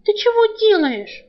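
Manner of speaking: high, slow startled question; jolted awake and alarmed; startled demand. Acted. Girl waking to find Alk on her, startled.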